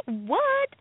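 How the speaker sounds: a laughing, high-pitched squeal of "What?"; shocked what. Retold or imitated. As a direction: squeak it out in disbelief. Shocked reaction to a mentor's offer.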